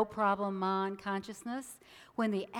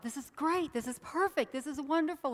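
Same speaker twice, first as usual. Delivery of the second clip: delighted; 7 semitones higher than usual. Acted. Man's delighted thought.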